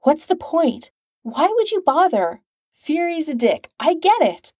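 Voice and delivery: high-pitched, urgent pressing; manic urgency. A narrator's reading. Stark pressing Coulson for a reason, manic and urgent. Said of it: "with a manic urgency".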